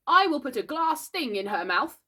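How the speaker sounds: high, loud pronouncement; boy's self-important doctor voice; pretend doctor. A narrator's reading. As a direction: announce treatment like a child playing doctor.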